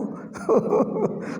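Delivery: a short high-pitched breathy laugh. Real, not acted. Teacher laughing at his own joke, high short laugh. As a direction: laugh out at the absurd idea.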